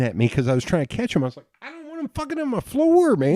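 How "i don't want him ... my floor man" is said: high-pitched, worked-up objection; high-pitched retold outburst; retold panicked protest. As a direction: protest in a much higher voice, half-yelling. Protesting during an argument, retold.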